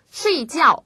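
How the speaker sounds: 睡 and 觉 each start high and fall; two downward strokes on 睡觉; double falling tone. Shown as a demonstration. Teacher models tones for learners, 睡觉 both falling. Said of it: "두 번 다 떨어지기 때문에".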